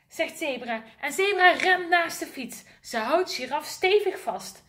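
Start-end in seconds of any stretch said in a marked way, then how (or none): none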